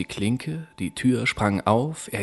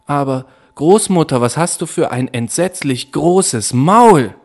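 put-on higher, louder, fast horrified child; girl horrified at the huge mouth; frightened girl; 5 semitones higher, 6 dB louder than usual. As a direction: blurt it out in fear. Rotkäppchen frightened.

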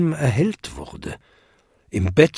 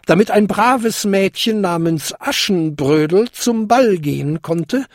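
high fairy godmother voice; boastful old lady; 7 semitones higher than usual. A narrator's reading. Old lady recalling Cinderella's coach, boastful. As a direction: boast of your famous magic.

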